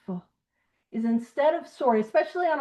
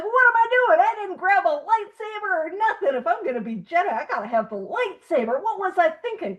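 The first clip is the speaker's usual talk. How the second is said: high-pitched comic dismay; exaggerated forehead-slap panic; mock self-reproach; 6 semitones higher than usual. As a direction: berate yourself in playful exasperation, voice jumping up. Acted self-scolding over forgotten lightsaber.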